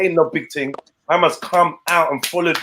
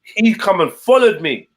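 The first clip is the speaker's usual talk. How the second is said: ordinary talk, nothing marked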